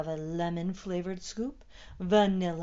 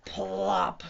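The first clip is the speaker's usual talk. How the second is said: louder, stretched, popped "plop"; plop sound effect; 5 dB louder than usual. A narrator's reading. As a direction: drop a comic plop. Hat lands.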